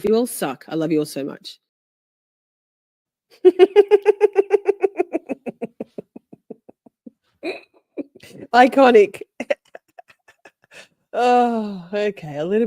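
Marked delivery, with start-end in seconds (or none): 3.4-6.5 long high laugh. Real, not acted. Laughing at own outburst.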